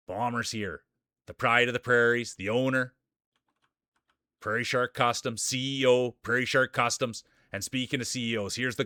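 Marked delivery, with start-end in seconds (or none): 1.3-7.2 loud, punchy hype-man intro; race announcer. Acted. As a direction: call the racers onto the track like a ringside announcer. Announces Bomber like a boxer.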